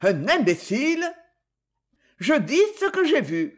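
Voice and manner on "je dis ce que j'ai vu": growled. A narrator's reading. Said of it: "gronda Franz".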